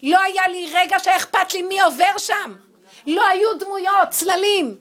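loud, high, forceful answer; emphatic declaration. Real, not acted. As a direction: declare it at full volume. Emphatic answer reliving the shouting row.

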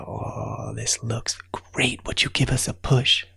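tiny whispered ant voices, slow; whispering ants. Acted. Little ants asking for a push, whispered.

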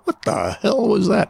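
retold startled high-pitched reaction; high, quick mock alarm. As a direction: replay the shock of a sudden bang, quickly. Startled reaction retold after the bang.

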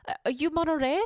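high-pitched Brazilian coworker impression; imitated new girl. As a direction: put on her high, foreign-sounding voice. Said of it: "She's like".

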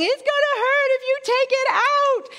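hammed-up child pleading in a high voice; panicking child. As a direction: whine it like a terrified kid. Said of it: "And he just starts flailing".

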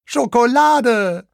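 very high, loud, drawn-out cheer; little pirate squealing with delight; jubilant shout. A narrator's reading. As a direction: whoop with joy, stretching the word. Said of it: "juchzt der kleine Pirat".

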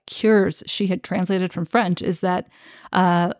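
usual speaking voice

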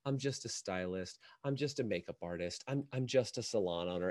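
small, low, apologetic mumble; mock shame. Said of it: "little respective shame".

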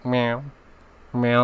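short, spoken mock meows; imitated cat meow. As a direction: do a quick pretend cat meow. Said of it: "and then purring".

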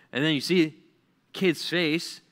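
usual speaking voice

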